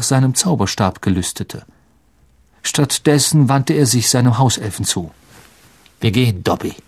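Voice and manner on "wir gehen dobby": lower, curt order to a servant; lightly voiced cold master; Malfoys Befehl. A narrator's reading. Malfoy orders his house elf away. (leicht angedeuteter herrischer Hausherr)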